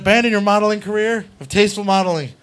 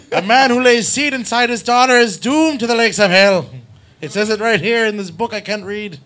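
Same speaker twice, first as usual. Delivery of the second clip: raised-pitch mock Irish sermon; old Irish preacher; 5 semitones higher than usual. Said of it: "an old Protestant Irish fucking preacher".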